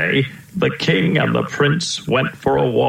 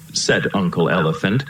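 plain reading voice

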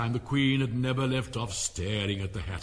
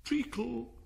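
higher drowsy murmur; sleepy voice; 12 semitones higher than usual. Acted. Dormouse answers sleepily from behind the cook. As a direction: mumble it half asleep. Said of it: "said a sleepy voice".

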